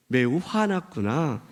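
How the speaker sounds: very slow, calm acknowledgement; gentle. Acted. God replies to Jonah's outburst, gentle and unhurried. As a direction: say it soothingly, drawn out. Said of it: "하나님이 부드럽게".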